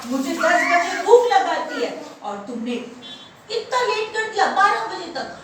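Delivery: loud, high scolding lion king; angry lion. Acted. Hungry lion tells off the late rabbit, angry. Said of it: "मैं तुमसे नाराज हूँ".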